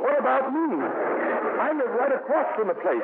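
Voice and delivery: alarmed. Acted. Alarmed about nearby fertilizer plant.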